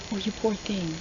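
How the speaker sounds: soft, sincere pity; soft sympathy. Pretend. He pities her scars, softly and sincerely. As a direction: say it gently, unironically. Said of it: "he wants to say it like that".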